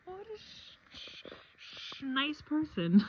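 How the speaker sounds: high, slow swallowed curse; catching a swear. Real, not acted. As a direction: stop yourself mid-swear. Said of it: "I wasn't gonna say sh".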